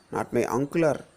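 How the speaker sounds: usual speaking voice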